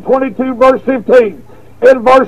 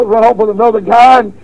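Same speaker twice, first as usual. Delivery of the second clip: ordinary talk, nothing marked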